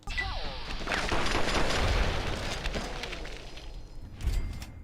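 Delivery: long, breathless real laugh; helpless laugh. Real, not acted. Streamer cracks up after teasing a viewer, helpless laughter. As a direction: crack up, mostly without voice.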